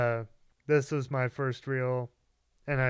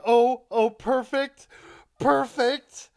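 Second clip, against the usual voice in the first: high, loud glee fresh from laughing; gleeful delight; 10 semitones higher, 9 dB louder, slower than usual. Retold or imitated. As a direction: relish it, voice raised in glee. Retells delight at the spit take.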